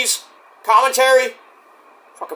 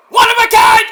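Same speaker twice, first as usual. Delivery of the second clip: high, loud commentator call; excited hype; 8 dB louder than usual. Real, not acted. Fan calls the signature move like a hyped commentator.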